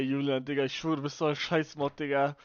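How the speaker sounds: ordinary talk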